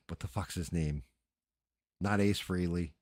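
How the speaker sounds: ordinary talk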